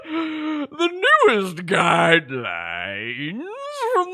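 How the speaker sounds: put-on loud, slow wobbly old doctor voice; a quavering public announcement, hammed up; warbling mock official message; Fauci voice clip. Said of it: "Is that what Doctor Fauci sounds like?".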